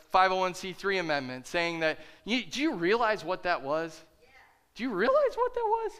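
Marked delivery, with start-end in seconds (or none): 4.7-5.9 much higher repeat. Real, not acted. Pastor challenging his congregation, much higher. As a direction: realize leapt up much higher.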